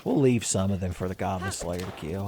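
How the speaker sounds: ordinary talk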